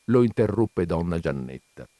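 ordinary delivery

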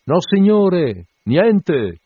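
high, loud, drawn-out hail; shouting through cupped hands. A narrator's reading. Sailor reporting to his captain, shouting. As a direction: shout it long across the water. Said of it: "mise le mani a portavoce e gridò".

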